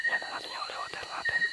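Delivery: hushed whisper; whispered aside to viewers. Real, not acted. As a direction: keep it secret, barely breathing. Whispering about the holy water.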